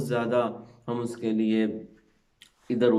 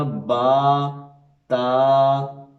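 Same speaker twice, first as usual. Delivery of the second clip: louder, slow, held letters; full-voiced letters; 3 semitones higher, 6 dB louder, much slower than usual. Shown as a demonstration. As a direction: project با and تا slowly. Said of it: "آواز پوری باہر نکالیں".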